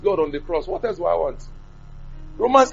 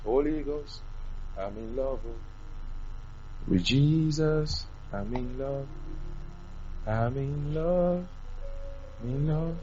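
soft, slow, drawn-out adoration; lovestruck devotion; 10 dB quieter, slower than usual. Acted. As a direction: croon it quietly as if lost in love. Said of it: "You have to be mad in love with him".

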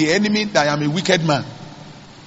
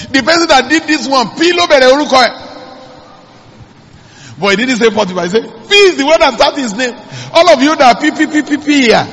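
higher, louder preaching build; fiery preaching; 6 semitones higher, 3 dB louder than usual. Acted. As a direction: preach it out to the congregation. Preacher at full force, higher and louder.

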